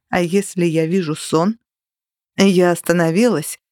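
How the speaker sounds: ordinary delivery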